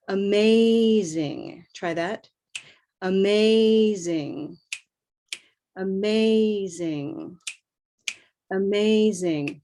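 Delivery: "Amazing" slow, with the A stretched and gliding; moving A in amazing. Shown as a demonstration. Teacher modelling slow "Amazing" for students to copy. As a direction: draw out the A in "Amazing". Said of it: "move that vowel sound".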